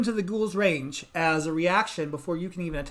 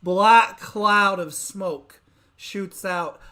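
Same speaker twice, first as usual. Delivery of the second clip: dramatic narration; 4 dB louder, much slower than usual. A narrator's reading.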